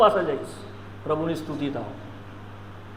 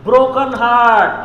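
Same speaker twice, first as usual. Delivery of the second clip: Broken heart, drawn out very slow and much louder; stressed slowly; 8 dB louder, much slower than usual. Real, not acted. Preacher to the congregation, Broken heart slow and loud. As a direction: lean hard on Broken heart, word by word. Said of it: "અંદર તૂટી ગયેલું હૃદય".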